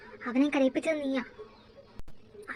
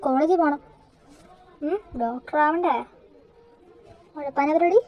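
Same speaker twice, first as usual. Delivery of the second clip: mock scolding; 7 dB louder than usual. Sister scolding brother to go.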